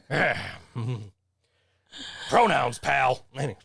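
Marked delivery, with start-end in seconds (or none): 2.3-3.2 light take on a wrestling boss, a bit louder; a hint of Vince McMahon sneering; Vince McMahon impression. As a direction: growl it like a wrestling promoter. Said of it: "how you do a Vince McMahon impression".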